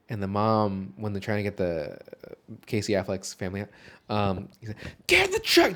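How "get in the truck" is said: high, loud imitation of a yelled order; mock yell. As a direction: yell it like a frantic command. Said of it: "Just yells at this woman".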